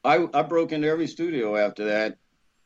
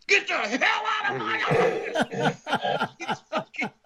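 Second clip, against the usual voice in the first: high-pitched mock furious shout; coming unglued; 14 semitones higher, slower than usual. Studio man throwing out the intruder, hammed-up high shouting. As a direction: blow up and throw him out, breaking into laughter. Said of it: "He came unglued".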